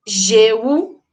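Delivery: ordinary talk, nothing marked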